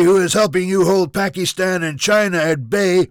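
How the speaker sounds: high, loud tirade; furious shouted threats. Acted. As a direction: yell so it carries into the next room. Junior yells threats at Prime Minister.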